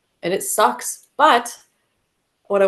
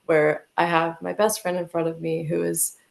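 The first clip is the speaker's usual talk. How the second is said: usual speaking voice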